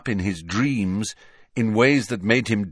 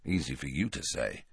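low, soft grumble; quiet aside, lower in pitch; muttered; 5 semitones lower, 10 dB quieter than usual. A narrator's reading. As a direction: mutter it under your breath. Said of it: "muttered Harry".

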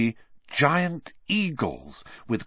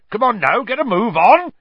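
booming call across the yard; 12 semitones higher, 9 dB louder than usual. A narrator's reading. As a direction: call the class over, loud and high. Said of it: "he called as the class approached".

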